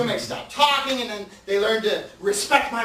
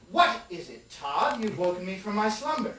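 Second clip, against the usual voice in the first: lower, soft, stuffy proper roommate; 6 semitones lower, 4 dB quieter than usual. Acted. The roommate answers Todd, lower and softer, prim and proper. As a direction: play the prim roommate just woken up, lower.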